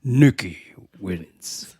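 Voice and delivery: lightly voiced fighting-game announcer, part whispered. Co-host joking about a brutal scene, mock game announcer. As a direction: imitate a video game victory call.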